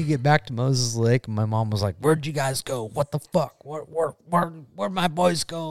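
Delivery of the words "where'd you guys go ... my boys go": higher, softer stammered questions; retold worried mom. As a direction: imitate a mother looking for her boys. Mom's frantic questions acted out.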